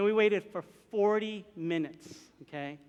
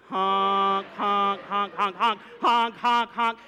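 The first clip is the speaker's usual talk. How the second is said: repeated car-horn honks; insistent, punched-out honk after honk; 4 dB louder, much slower than usual. Retold or imitated. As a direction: imitate someone leaning on the horn. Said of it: "Starts honking".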